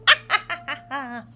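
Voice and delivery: loud high evil cackle; witch laugh. Acted. Snow Witch cackles at the adventurers, evil cackle. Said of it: "Her encased head starts to laugh".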